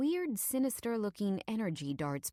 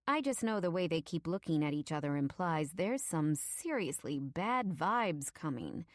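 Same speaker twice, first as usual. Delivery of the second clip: ordinary delivery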